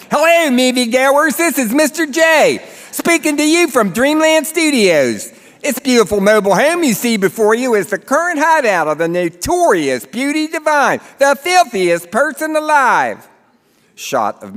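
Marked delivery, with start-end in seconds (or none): 0.1-11.0 high, loud Baltimore narrator, hammed-up; thick Baltimore accent. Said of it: "He has a thick Baltimore accent". 11.2-13.3 put-on "the filthiest person alive" announced high in a Baltimore accent; thick Baltimore accent. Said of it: "He has a thick Baltimore accent".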